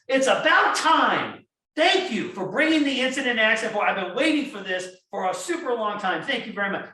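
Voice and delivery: loud, high, slowed-down cheerful thanks; grateful welcome demo. Shown as a demonstration. To staff reporting an accident, loud and warmly thrilled. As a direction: show them how to welcome an incident gladly. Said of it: "that's the way we should deal with".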